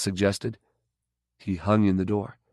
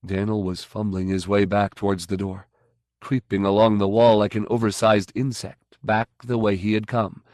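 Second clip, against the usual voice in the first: usual speaking voice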